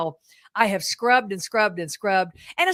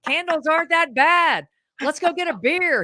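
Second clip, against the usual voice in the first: higher, louder pal urging him to quit; buddies teasing Tom; 5 semitones higher, 4 dB louder, slower than usual. Retold or imitated. Friends coaxing Edison toward a beer, higher and louder. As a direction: egg him on like a bar buddy.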